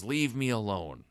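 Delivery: ordinary talk, nothing marked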